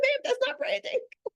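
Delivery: high, amused mock scolding; incredulous retort. Coach retells correcting the client, high amused disbelief. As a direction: scold her with a laugh in the voice. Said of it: "It's like".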